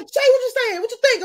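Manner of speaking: high, loud pushy friend; arguing friend. Retold or imitated. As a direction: demand an answer mid-argument. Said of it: "he's like".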